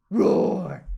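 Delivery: loud growling roar; sleepy bear's loud roar. Acted. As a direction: wake up roaring like a grizzly. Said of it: "the bear growled".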